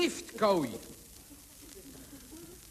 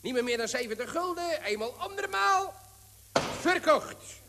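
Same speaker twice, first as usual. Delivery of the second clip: quick rising auction patter, climbing higher; auctioneer; 5 semitones higher than usual. Acted. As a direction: rattle off bids like an auctioneer. Auctioneer calls rising bids.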